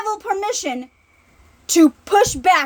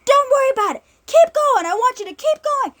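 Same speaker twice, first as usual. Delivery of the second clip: pushing coach; 8 semitones higher than usual. Retold or imitated. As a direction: drive him forward from the sideline. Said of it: "And the coach goes".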